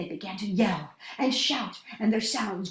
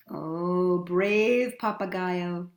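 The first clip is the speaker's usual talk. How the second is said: plain reading voice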